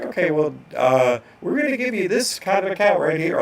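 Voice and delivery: bright sing-song clerk announcement; bank lady. Retold or imitated. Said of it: "she's like".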